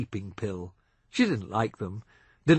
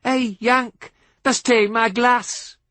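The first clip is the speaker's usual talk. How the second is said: loud, higher, slow taunting call; 11 semitones higher, 7 dB louder, slower than usual. A narrator's reading. Drunk farm lad calling after the Yank, loud and taunting. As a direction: shout it after him across the pub. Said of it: "called out after him".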